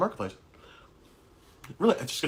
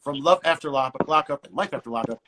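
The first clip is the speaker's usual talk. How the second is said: upbeat radio announcer read, building in pitch; radio host intro; 3 semitones higher, 3 dB louder than usual. Acted. Host opens radio portion introducing special guest.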